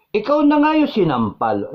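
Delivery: high, loud indignant cry; indignant outburst. Real, not acted. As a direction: burst out in outrage at the injustice. Retelling victim slapped, indignant. (galit na pagsigaw)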